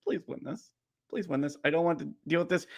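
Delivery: soft, pleading under the breath; quiet plea. Real, not acted. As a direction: beg the team quietly to win. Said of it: "praying during the power play".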